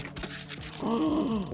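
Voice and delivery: long, high, soft gasp; acted gasp. Gamer voices shock at the alien growing, acted gasp. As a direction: draw in a long mock-horrified breath. Character reacts to growing back.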